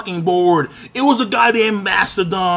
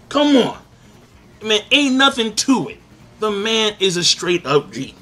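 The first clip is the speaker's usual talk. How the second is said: ordinary delivery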